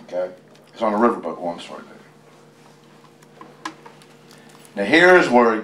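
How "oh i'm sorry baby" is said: slow, hushed apology; soft whisper. Real, not acted. Father whispering an apology to his baby, slow.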